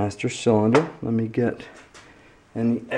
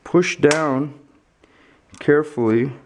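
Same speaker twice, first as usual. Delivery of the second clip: flashlight in mouth. Real, not acted. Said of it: "I have the flashlight in my mouth".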